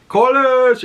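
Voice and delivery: high, loud call across the water. Retold or imitated. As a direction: shout for the lost lord. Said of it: "and he's just like".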